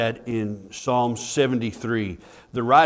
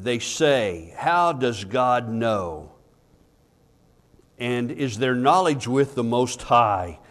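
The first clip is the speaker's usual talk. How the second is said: raised voice reading of scripture; 4 dB louder than usual. Acted. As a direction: read the psalm out with weight. Reads scripture aloud, louder.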